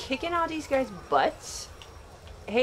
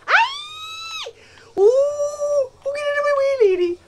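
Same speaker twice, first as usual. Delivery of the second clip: high, loud frantic squeal; panicked shriek; 14 semitones higher, 8 dB louder, slower than usual. Real, not acted. Player yelling at lady blocking the way, panicked shriek. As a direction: yell in alarm at someone in the way.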